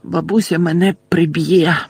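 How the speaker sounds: lamenting. A narrator's reading. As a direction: fret aloud like a boy in trouble. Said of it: "бідкався Невіл".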